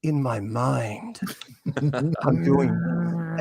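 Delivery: slow, drawn-out explanation; lightly voiced eccentric artist, stretching the words; imagining painter. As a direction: imitate someone earnestly explaining their imaginary art. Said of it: "points to her head and she goes".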